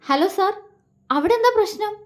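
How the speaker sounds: high-pitched worried phone query; acted alarm, pitched well up; frightened. A narrator's reading. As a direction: ask anxiously, voice lifted high. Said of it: "മറുവശത്തുള്ള ആളുടെ സ്വരത്തിൽ പേടി".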